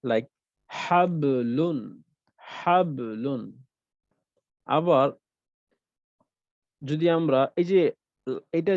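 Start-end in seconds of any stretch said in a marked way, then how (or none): 0.7-3.4 হাবলুন very slow, with a light bounce on the b; small qalqala. Shown as a demonstration. Teacher demonstrating হাবলুন with small qalqala. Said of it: "তাহলে আমরা কিভাবে উচ্চারণ করব?".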